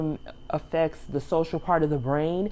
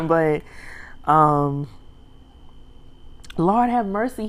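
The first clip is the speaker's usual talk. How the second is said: ordinary delivery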